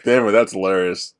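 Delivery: ordinary talk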